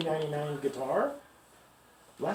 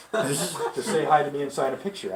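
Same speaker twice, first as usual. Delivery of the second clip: usual speaking voice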